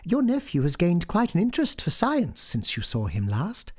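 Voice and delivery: high-pitched woman's voice; persuasive sister. A narrator's reading. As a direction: coax brightly, speeding up at the end.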